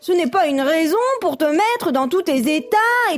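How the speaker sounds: high-pitched, unimpressed frog neighbour; exasperated little creature; scolding frog. A narrator's reading. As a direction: chide the panicker in a high voice.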